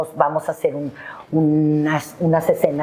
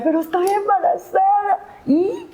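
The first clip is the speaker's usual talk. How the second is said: excusa aguda. Retold or imitated. Se excusa ante los compañeros.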